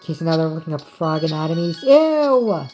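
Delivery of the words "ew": high, loud "ew" of disgust. Real, not acted. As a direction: recoil at something gross. Reacting to frog pictures on screen.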